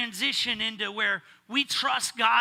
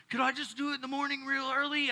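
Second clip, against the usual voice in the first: put-on higher, quick little-boy plea; pleading kid; 7 semitones higher, faster than usual. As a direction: beg like a kid dodging a chore. Son pleads to delay chore.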